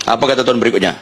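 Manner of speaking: normal talking voice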